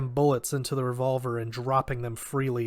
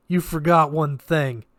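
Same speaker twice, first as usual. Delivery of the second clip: taunting; 3 semitones higher, 7 dB louder than usual. Acted. Taunting the enemy.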